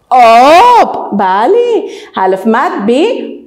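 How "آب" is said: slow, louder, stretched vowel; drawn-out model word. Shown as a demonstration. Teacher sounding out a word for pupils, slow and loud.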